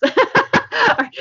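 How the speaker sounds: high laughing burst; bursting into laughter. Real, not acted. Laughing after the demo.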